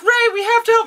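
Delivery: very high put-on heroine; mock urgent plea. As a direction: plead in a squeaky voice. Acts out Felicity pleading.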